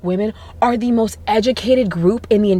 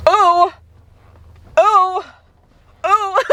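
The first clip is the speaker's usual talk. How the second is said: high, loud fake gasps of shock; mock gasp; 10 semitones higher, 5 dB louder, much slower than usual. Teasing her audience with a mock shocked gasp. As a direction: gasp as if scandalized, but joking. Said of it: "I'm kidding".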